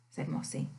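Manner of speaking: usual speaking voice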